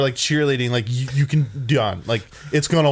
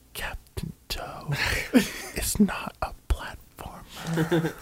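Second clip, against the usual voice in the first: right in your ear; 4 semitones higher, 8 dB quieter, much slower than usual. Pretend. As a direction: close soft whisper, slow. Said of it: "I just get up close and go".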